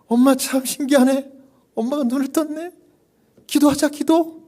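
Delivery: thrilled, rush her to pray; amazed daughter. Retold or imitated. Daughter amazed mother opened her eyes, high and excited.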